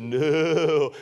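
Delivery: long, stretched-out mock "no"; drawn-out refusal. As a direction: draw out a reluctant refusal. Acted refusal in cliff story.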